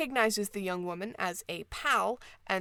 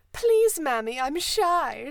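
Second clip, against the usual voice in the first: high, loud mimicry of a shy child; mock blushing child; 7 semitones higher, 7 dB louder, slower than usual. A narrator's reading. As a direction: play a bashful kid, hammed-up. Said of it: "grotesque mimicry of the air of a blushing child".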